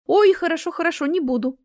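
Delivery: high, delighted quick agreement; радостно. A narrator's reading. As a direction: agree happily, jumping up in pitch. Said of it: "захлопала Марина в ладоши". (радостно, высоким голосом)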